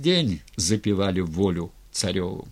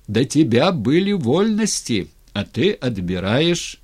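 raised, higher voice carrying to a crowd; loud public speech; 4 semitones higher, 6 dB louder than usual. A narrator's reading. Razin complaining to the tsar about lost liberties, loud. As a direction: speak up so everyone hears. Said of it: "Разин говорил громко, всем".

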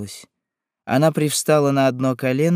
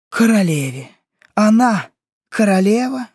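outraged echo, climbing high and loud; mounting fury; 8 semitones higher, 5 dB louder than usual. A narrator's reading. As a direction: repeat the title in scornful disbelief. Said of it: "бешенство возрастало с каждой минутой". (нарастающее бешенство)

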